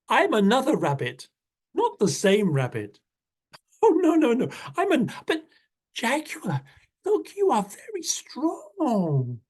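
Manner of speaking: a tiny rabbit flattering the big cat; little rabbit. Acted. Rabbit coaxing jaguar into his house, high and pleading.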